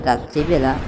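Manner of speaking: ordinary delivery, nothing marked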